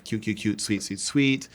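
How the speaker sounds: normal talking voice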